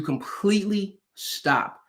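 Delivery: "completely" lifted, pause, then "stop"; emphatic halting. Shown as a demonstration. Coach explaining what cease means, "completely... stop" slow and halting. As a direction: stretch "completely", halt, then set down "stop" alone.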